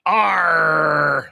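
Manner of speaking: a loud shouted arr; loud, mock pirate roar. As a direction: bellow it like a pirate. Pirate joke punchline.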